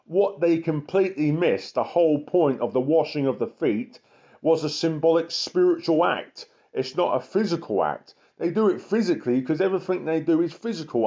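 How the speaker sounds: fiery street preacher; preaching at full force. Acted. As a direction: preach it hard to the congregation. Preaching against the law.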